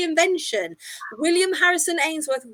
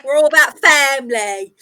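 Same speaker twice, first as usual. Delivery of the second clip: louder mock Essex pub landlord; dodgy Essex accent; 7 dB louder than usual. Essex man refusing to pay for a letter, mock Essex accent. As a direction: ham up an Essex geezer. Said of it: "that's how they talk down in Essex".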